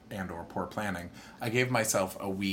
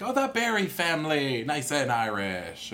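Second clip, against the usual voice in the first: Irish clerk voice; 7 semitones higher, 4 dB louder than usual. Retold or imitated. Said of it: "she's like".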